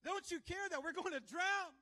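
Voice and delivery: high, loud cries of alarm; panicked shouting. Acted. Disciples waking Jesus in the storm, panicked shouting. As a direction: shout it like a frantic, hammed-up drowning man. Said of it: "they were shouting".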